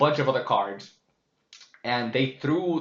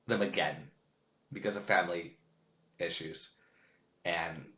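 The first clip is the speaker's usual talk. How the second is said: quiet sadness; 4 semitones lower, 6 dB quieter than usual. Real, not acted. Sad about losing childhood cards.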